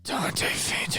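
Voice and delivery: breathy whispered, worn out. Pretend. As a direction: whisper it, utterly fed up. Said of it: "Fourth time".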